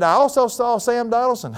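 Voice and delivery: high-pitched, gleeful report; overexcited daughter. Retold or imitated. Daughter boasting to dad of another sighting, overexcited. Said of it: "how joyful she was and overexcited".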